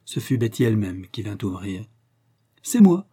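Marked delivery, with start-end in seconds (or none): 2.6-3.1 high, loud, happy call; joyful cry. A narrator's reading. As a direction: announce yourself in a burst of joy. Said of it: "s'écria joyeusement Léon".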